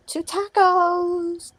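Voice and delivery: high, long-drawn-out call; sing-song greeting. Real, not acted. Host greets viewer arriving on the live, calling out. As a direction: stretch the name out in welcome.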